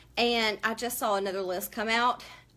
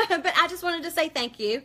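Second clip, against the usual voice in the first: holding back tears; 5 semitones higher, 4 dB louder than usual. Real, not acted. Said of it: "But I don't wanna cry".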